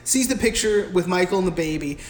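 ordinary delivery

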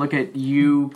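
ordinary delivery

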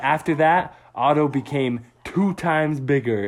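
ordinary delivery, nothing marked

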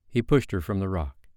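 ordinary delivery

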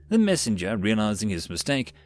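plain reading voice

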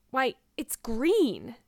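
slow, drawn-out exclamation, swooping on GREEN; astonished. A narrator's reading. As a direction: exclaim in shocked discovery. Marilla discovers the green hair.

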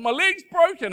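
hammed-up child howling about a broken leg; whiny hurt kid. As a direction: wail it like a melodramatic little kid. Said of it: "Um, and".

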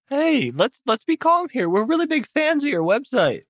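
high-pitched, ingratiating appeal; placating fan. Acted. Fan tries to calm the hostile homeowner, placating.

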